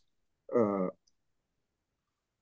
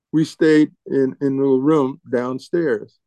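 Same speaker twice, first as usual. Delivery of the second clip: ordinary talk, nothing marked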